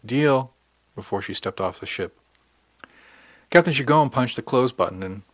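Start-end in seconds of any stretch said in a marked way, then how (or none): 0.1-0.5 a quick, louder call back; called over shoulder. Acted. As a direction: fling the word back over your shoulder. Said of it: "called over her shoulder".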